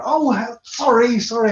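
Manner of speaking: a startled mock rider.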